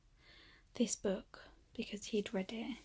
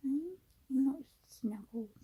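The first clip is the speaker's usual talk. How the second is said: put-on higher, slow cooing baby voice to the cat; cooing pet talk; 5 semitones higher, slower than usual.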